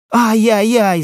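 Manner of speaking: high-pitched yelp of pain; high wailing "ow-ow-ow"; boy's cry of pain. A narrator's reading. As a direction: cry out like a child just hit on the leg. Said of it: "закричал мальчик".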